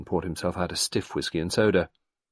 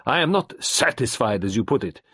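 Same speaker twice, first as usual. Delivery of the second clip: louder, higher French doctor rising in protest; impassioned Frenchman; 5 semitones higher, 5 dB louder than usual. A narrator's reading. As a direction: fight for life with conviction. Gerard impassioned.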